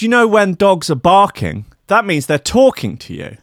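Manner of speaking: mock the woman's sincere, knowing tone; imitating the mad woman. Mocking the woman in the café: high, earnest imitation. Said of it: "she goes".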